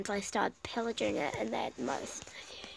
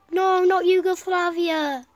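loud, higher, stretched wail of dismay; dismayed cry; 8 semitones higher, 10 dB louder than usual. Real, not acted. Gamer watching Yugoslavia fall, loud dismayed cry. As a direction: cry out as the country falls. Losing a country in game.

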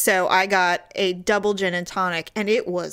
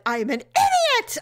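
higher, exasperated "I am an idiot"; mock self-scolding; 13 semitones higher than usual. Retelling how she scolded herself, mock exasperated. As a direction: scold yourself in a hammed-up way. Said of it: "I was so mad at myself".

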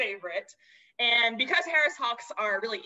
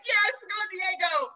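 high, mock crowd cheer; imitated crowd scream, held back; 7 semitones higher than usual. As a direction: cheer the bird on like an excited audience. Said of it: "the crowd is screaming, like".

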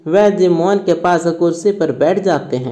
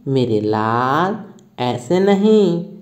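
lower, slow soothing mother; tender, slow mother's comfort; coaxing; 3 semitones lower than usual. Acted. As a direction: gently hush a sick child. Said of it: "माँ पुचकार कर बोलती है".

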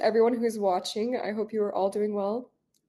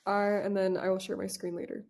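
usual speaking voice

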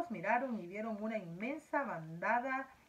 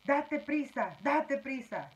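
louder, hurried insistence; impatient urging; 5 semitones higher, 5 dB louder, slower than usual. A narrator's reading. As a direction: hurry him up, insistently. Centipede urges James on.

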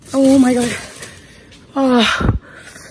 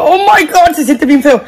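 real tears; 4 semitones higher than usual. Real, not acted. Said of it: "ya estoy llorando".

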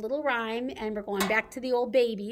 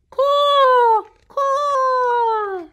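crow caw; 13 dB louder than usual. Acted. Reading, imitating the crow.

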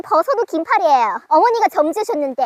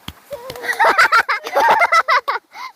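bright burst of laughter; high giggle of delight; 9 semitones higher than usual. Real, not acted. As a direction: laugh out, tickled. Mom laughs at applause.

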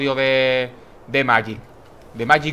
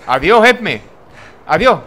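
mock farewell; 4 semitones higher, 7 dB louder than usual. Real, not acted. Companion dies.

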